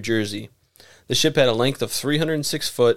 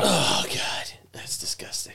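disgusted whisper; 4 semitones higher, 7 dB quieter than usual. Real, not acted. Reacting to sounding rod.

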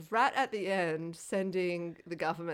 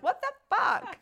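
much higher and louder, comic disbelief; squeaky what the fuck; 6 dB louder, slower than usual. Retold or imitated. Reacting to the film, a high comic disbelieving yelp. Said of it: "I was kinda like".

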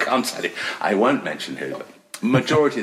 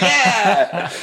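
high, loud, laughing "yeah"; drawn-out jubilant cry; mock triumph; 13 semitones higher, 6 dB louder than usual. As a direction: cheer your own small victory. Triumph after being called genius.

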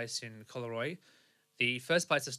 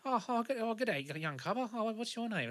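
lightly voiced gentle old bloke, higher pitch; gentle old man; 10 semitones higher than usual. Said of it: "kind of gentle person".

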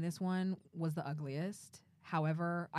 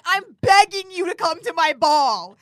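much higher and louder, pleading; hammed-up begging prince; 11 semitones higher, 18 dB louder than usual. Mocking Ken begging Barbie, high and loud. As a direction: overplay a desperate prince. Mocking Ken's plea, retold.

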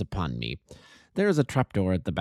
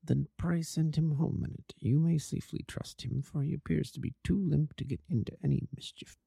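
much softer, kept low and secretive; hushed voice; 7 dB quieter than usual. Acted. Holmes quietly instructs Watson in the opium den, hushed. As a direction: murmur so the den cannot overhear. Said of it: "As low as you can".

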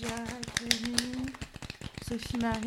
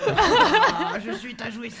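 mock villain voice; 10 semitones higher, 11 dB louder, slower than usual. Plays villain, laughing.

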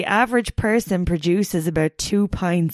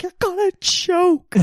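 scolding parent; 11 semitones higher than usual. Retold or imitated. Parent scolding in retold story.

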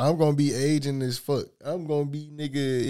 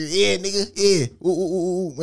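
mock hooper celebrating; 5 semitones higher, slower than usual.

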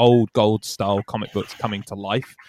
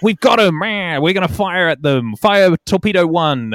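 high, loud mock villain barking orders; gloating villain; 6 semitones higher, 3 dB louder than usual. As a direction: ham up a cackling villain giving fire orders. Penguin gloating while firing torpedoes.

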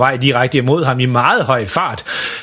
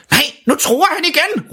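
much higher alarmed Santa; Santa shouting. Acted. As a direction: cry out in alarm. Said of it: "råber julemanden".

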